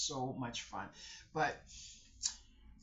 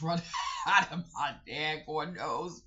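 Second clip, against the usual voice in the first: laughing; 8 dB louder than usual. Real, not acted. Laughing while retelling snot.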